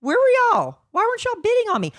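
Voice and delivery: high, loud exasperated cry; exasperated outburst. Retold or imitated. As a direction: wail it out in mock outrage. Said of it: "I'm like".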